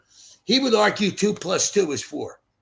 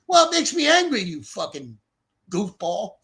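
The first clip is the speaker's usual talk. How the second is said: retold angry comeback; 4 semitones higher, 4 dB louder than usual. His angry retort to Reese.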